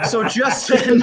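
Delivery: trying to resume through laughter; laughing through words. Real, not acted. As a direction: break up while restarting the joke. Cracks up as dogs interrupt.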